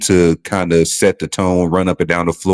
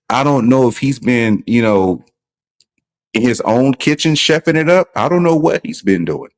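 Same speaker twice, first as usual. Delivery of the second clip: usual speaking voice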